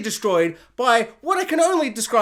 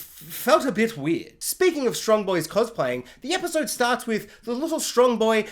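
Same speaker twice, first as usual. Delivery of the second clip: ordinary delivery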